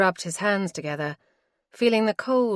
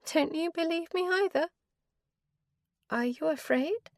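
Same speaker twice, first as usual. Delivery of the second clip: higher-voiced wife, cold and weary; wife's voice; 12 semitones higher than usual. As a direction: she refuses him, tired and final. Wife's voice again.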